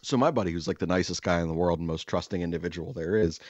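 ordinary talk, nothing marked

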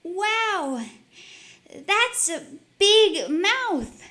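high, slow, wide-eyed wonder; awed girl; amazed girl. Acted. Maria amazed.

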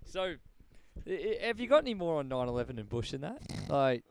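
ordinary delivery

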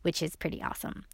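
breathy whispered aside, quick. Real, not acted. Podcast host whispering a quick aside to listeners. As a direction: lean in and whisper it.

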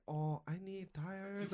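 slow, soft, timid customer voice; a hushed, unsure little ask for tires; imitating a customer.

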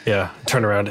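ordinary talk

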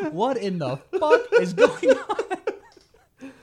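slow, high, loud disbelief through laughter; hammed-up bewildered yelling; exasperated drill onlooker. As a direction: stretch every word in laughing outrage. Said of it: "like the dumb people who couldn't do jumping jacks".

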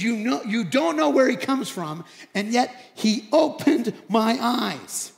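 loud, emphatic, slowing to spell it out; bolder. Retold or imitated. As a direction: challenge them with the obvious. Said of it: "he's just getting bolder".

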